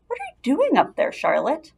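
high, curious call upward; lightly voiced pig calling up to the spider. A narrator's reading. Wilbur calling up.

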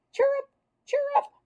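high squeaky cricket chirrups; cricket chirp. Acted. Boy proves to the class he made the noise, high cricket chirp. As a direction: show off a perfect cricket chirp. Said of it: "Kirk really sounded like a cricket".

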